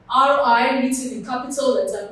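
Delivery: usual speaking voice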